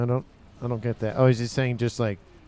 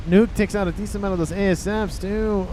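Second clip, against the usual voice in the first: excited; 9 semitones higher, 4 dB louder than usual. Real, not acted. Nuke hits the ASFs.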